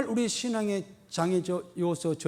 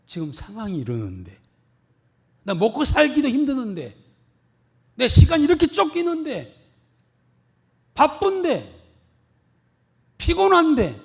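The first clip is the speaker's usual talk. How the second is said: higher, louder building list of excuses; whiny excuses; 7 semitones higher, 7 dB louder than usual. Retold or imitated. Churchgoer making excuses to the pastor, whining louder. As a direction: pile up complaints, rising each time. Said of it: "대드는 가장 무기가".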